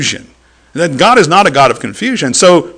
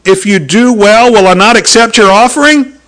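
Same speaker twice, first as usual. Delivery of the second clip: firm divine voice; 5 semitones higher, 6 dB louder than usual. Retold or imitated. God speaking to Cain.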